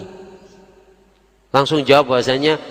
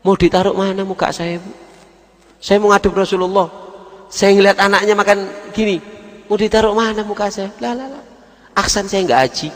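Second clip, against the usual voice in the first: high, urgent plea; ashamed pleading; 10 semitones higher than usual. Acted. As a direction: beg her, shamed. Cleric pleads, ashamed before Prophet. (memohon dengan malu, nada tinggi)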